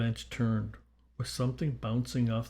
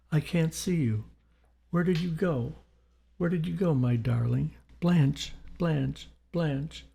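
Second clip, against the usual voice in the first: higher-pitched, searching cries; anxious calling; 5 semitones higher than usual. A narrator's reading. Reverend's spirit searching for Blanche, anxious calling. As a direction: call out for someone lost in fog.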